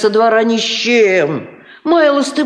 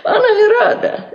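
laughing gossip; 7 semitones higher than usual. Acted. Gossiping about the wedding.

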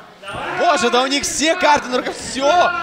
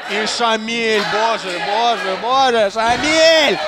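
shocked; slower than usual. Real, not acted. Wrestler takes big hit.

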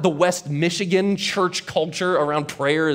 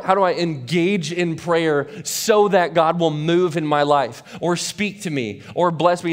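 ordinary delivery

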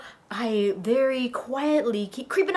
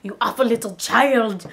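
loud, lower villain voice; angry orc; 4 semitones lower, 3 dB louder than usual. Acted. Orcs silencing the screaming girl, angry. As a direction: cut the child off harshly.

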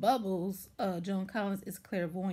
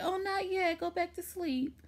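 much higher, sing-song hush-now voice; sugary soothing grandma; 10 semitones higher than usual. Retold or imitated. Joan Collins' character soothing her granddaughter, in a high sugary voice. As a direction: coo the kid back to bed, sweetly fake.

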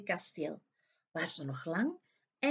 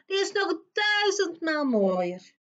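higher, slow, pointed reveal; mirror's verdict; 8 semitones higher, 4 dB louder than usual. A narrator's reading. Magic mirror tells the queen, slow and higher. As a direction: let the mirror deliver the blow slowly. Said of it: "de spiegel zei".

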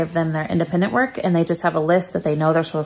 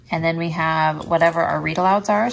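normal talking voice

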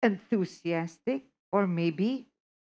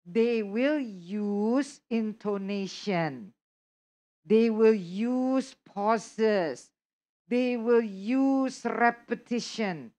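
slow emphatic delivery; much slower than usual. Shown as a demonstration. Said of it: "I'm sure your lectures do not do that".

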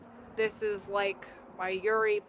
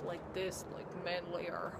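lower voice; 3 semitones lower, 9 dB quieter than usual. Shown as a demonstration. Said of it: "go for a lower voice".

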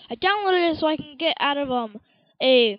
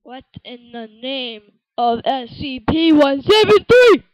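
rising frustration into a yell; exasperated yell; 10 dB louder than usual. Real, not acted. Gamer fed up with loading, yelling.

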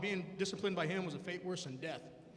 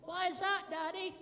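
put-on high child voice; small son asking his Irish father, high kid voice; little kid; 10 semitones higher than usual. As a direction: pitch way up like a small boy.

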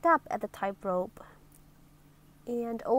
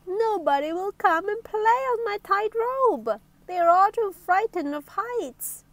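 high, plaintive character voice; tearful complaint; 8 semitones higher than usual. Acted. Lonely Miss Somersault explaining nobody will play, tearful complaint. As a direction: lament in a raised, sorrowful voice. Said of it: "she sobbed".

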